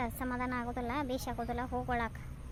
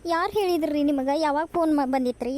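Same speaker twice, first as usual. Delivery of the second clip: loud, urgent questions; alarmed questioning; 3 semitones higher, 6 dB louder than usual. Acted. Daughter-in-law asks her mother-in-law who phoned, alarmed. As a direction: press anxiously for the news.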